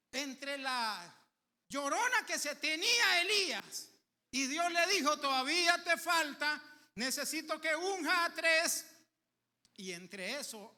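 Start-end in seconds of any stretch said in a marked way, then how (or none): 0.1-8.9 preaching at full force. Acted. Preacher tells congregation what God does, at full force.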